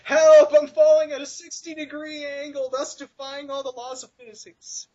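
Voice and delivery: high, drawn-out falling cry, fading off; mock screaming. Fan imitates riffer voicing a dropped man, mock scream fading. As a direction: wail it slowly, loud start then trailing away. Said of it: "he starts screaming".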